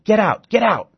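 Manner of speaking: high, loud furious snarl; snarled get out. Acted. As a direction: drive the doctor away, snarling. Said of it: "snarls at me".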